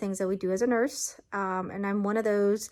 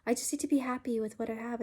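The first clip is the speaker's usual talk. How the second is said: ordinary talk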